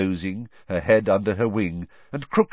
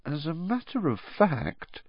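high, softer, faintly trembling voice; a touch of tremble, held back; slightly trembling; 8 semitones higher, 6 dB quieter than usual. A narrator's reading. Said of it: "her voice now trembling slightly".

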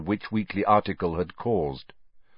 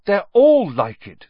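louder and higher, slow and distraught; desperate; 11 semitones higher, 9 dB louder than usual. Acted. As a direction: cry it out in dismay. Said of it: "said Hermione desperately".

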